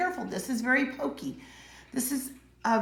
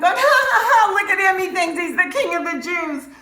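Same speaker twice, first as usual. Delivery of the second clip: much higher, loud cackling jeer; mocking laughter; 10 semitones higher, 11 dB louder than usual. Roman soldiers mocking Jesus on cross, high loud jeering. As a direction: ridicule him from below the cross. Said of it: "soldiers are making fun of him".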